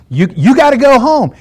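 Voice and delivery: acted-out insistent send-off; firm command. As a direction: shoo a dog home firmly. Telling stray dog to leave.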